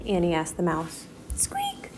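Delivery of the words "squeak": high squeak. Acted. Mouse squeak imitated.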